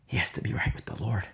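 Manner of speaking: hushed, quick whisper. Real, not acted. Preacher to congregation, urgent whisper. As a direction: breathe it like a secret, fast. Stressing the key point.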